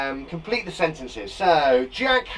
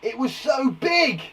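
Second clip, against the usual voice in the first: "so big" drawn out slow and high; emphatic exclamation; 7 semitones higher, 3 dB louder, slower than usual. Shown as a demonstration. Teacher showing exclamation makes things bigger, emphatic "so big". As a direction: make "so big" sound huge. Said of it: "it adds emphasis".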